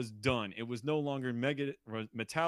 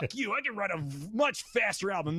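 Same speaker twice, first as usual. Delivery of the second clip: much higher, mock-defiant rant; 8 semitones higher, 3 dB louder than usual. [name] vowing to outdo Metallica, higher mock-defiant rant. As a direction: spit it back like a sulking rival. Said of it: "it's like".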